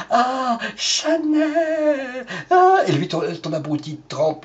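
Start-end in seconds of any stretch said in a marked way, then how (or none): 0.1-2.8 very slow, high dreamy sigh; hammed-up delight over perfume; mock swooning for Chanel. Shown as a demonstration. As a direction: stretch Chanel out like a spoiled wife. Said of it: "alors tu fais comme ça".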